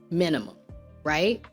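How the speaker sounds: normal talking voice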